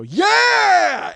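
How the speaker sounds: long, high, full-voiced victory yell; triumphant shout. Game show contestant celebrates hitting ten grand, triumphant shout. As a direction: belt out a drawn-out cheer. Acts out his winning celebration.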